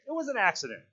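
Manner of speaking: high-pitched insistence; shrill. A narrator's reading. Piggy clings to his excuse, shrill. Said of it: "His voice shrilled again".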